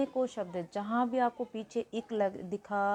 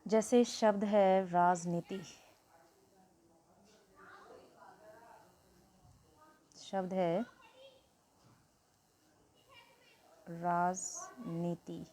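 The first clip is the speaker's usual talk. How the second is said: slow teacher presentation; much slower than usual. Shown as a demonstration. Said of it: "teacher slowly presents example word".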